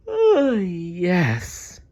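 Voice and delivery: sleepy yawning agreement. Acted. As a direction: say it through a yawn. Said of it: "yawned Maya".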